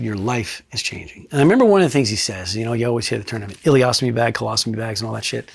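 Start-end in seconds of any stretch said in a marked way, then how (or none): 0.0-1.3 slower and softer, weighing each word; a hushed, slower repeat of the doctor's words; quieter retold line. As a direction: repeat it low, letting it land. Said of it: "But he said".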